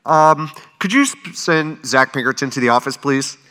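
mock office announcement over the PA; half-hearted loudspeaker call-in; school loudspeaker. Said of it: "over the loudspeaker".

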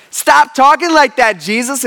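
mock scolding. Peter telling Jesus to stop, mock scolding. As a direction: scold him in exasperation, hammed up.

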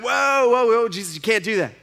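imitated high, loud objection; mock-alarmed interruption; alarmed protest. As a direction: cut in to stop him, voice leaping up. Martha acted out, stopping Jesus.

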